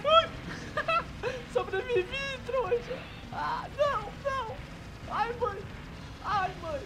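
high squealing panic; squeaky frantic cries while dodging; panicking gamer. Real, not acted. Dodging bullets in shooter game.

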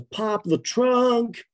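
high, slow imitated North American accent; attempted American accent. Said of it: "forgive me for my attempt to do an American accent".

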